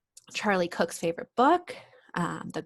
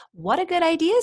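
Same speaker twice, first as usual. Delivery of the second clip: high, louder happy dragon; cheerful Zog; 8 semitones higher, 5 dB louder than usual. Acted. Zog thanks the girl, a high happy cry. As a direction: chirp it out delighted. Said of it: "said Zog".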